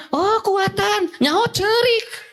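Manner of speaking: high, slow mock scolding of a crying child; scolding parent. A teacher mimics a parent scolding a fallen child, high and slow. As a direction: play a dismissive parent telling a child to toughen up. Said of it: "Tong gitu ibu".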